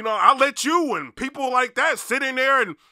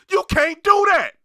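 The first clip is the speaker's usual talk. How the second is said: emphatic shout; 10 semitones higher, 6 dB louder than usual. Real, not acted. Repeated challenge, much louder.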